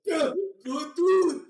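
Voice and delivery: high, loud, slow sermon voice in broken English; preachy parent storyteller. Retold or imitated. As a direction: preach the story of Job like a strict dad. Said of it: "he'd be like".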